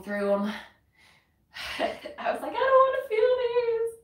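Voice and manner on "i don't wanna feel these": mock whiny self-quote. Quoting her own reluctant thought.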